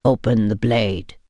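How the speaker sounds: low, patient, measured man's voice; patient vampire. A narrator's reading. Vampire patiently tells her to open the knife. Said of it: "he said patiently".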